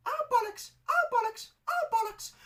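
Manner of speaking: put-on very high squeaky little boy swearing; squeaky child voice. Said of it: "that is how I sounded".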